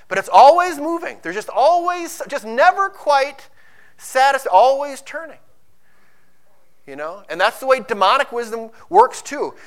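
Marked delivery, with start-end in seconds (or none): 0.1-5.4 loud, rising, driving sermon voice; preaching at full force. Acted. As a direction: hammer it home from the pulpit.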